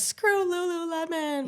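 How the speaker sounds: high, drawn-out mock protest; slow sing-out of mock indignation; imitated public outcry. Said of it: "So people were like".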